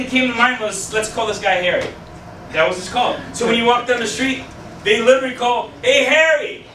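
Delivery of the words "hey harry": ordinary talk, nothing marked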